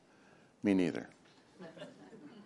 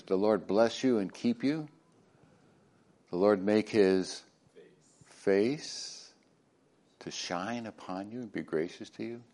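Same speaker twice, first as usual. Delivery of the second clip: solemn blessing; 2 semitones lower, 6 dB quieter than usual. Acted. Reads the priestly blessing.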